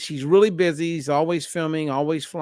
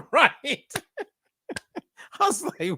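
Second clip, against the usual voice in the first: much higher, fast, laughing through the words; 11 semitones higher than usual. Real, not acted. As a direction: giggle through it. Laughing at the client's confession.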